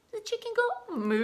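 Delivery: high, playful wrong answer; silly mistaken voice. Pretend. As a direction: pretend to get it wrong for laughs. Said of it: "So it's a chicken".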